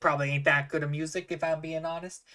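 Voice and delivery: folksy barkeep confiding an opinion; level with a customer as the innkeeper; candid halfling bartender. Acted.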